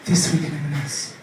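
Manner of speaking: soft, slow, sultry food-advert whisper; advert voiceover. Retold or imitated. M and S advert selling chicken, sultry whispered voiceover. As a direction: purr the menu in a hushed mock voiceover. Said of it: "The ones who are like".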